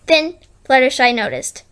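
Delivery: ordinary delivery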